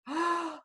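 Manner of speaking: sharp high intake of breath; big gasp. Shown as a demonstration. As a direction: gulp in air like a dragon. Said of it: "take a big, big breath and pretend".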